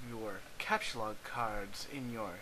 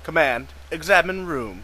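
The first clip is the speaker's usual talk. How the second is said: loud command; 2 semitones higher, 11 dB louder than usual. Acted. Player typing game command.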